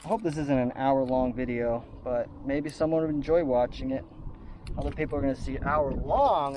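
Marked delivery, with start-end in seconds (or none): none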